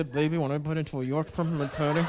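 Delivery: seductive words through clenched teeth; low sexy clenched-teeth murmur. Shown as a demonstration. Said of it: "talk through clenched teeth like that".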